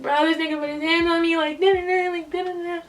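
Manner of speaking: high-pitched, worked-up reporting; upset complaint. Retold or imitated. Telling brother about being hit, high upset complaint. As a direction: re-enact a distressed call in a high voice.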